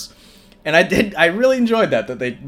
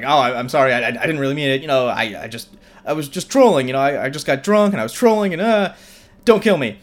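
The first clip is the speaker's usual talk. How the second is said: higher, louder, rushing nervous apology; frantic apology; 4 semitones higher, 5 dB louder, faster than usual. Retold or imitated. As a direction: babble sorry in a panic, pitch climbing at the end. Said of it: "apologizing profusely".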